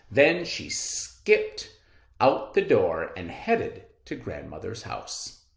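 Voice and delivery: ordinary delivery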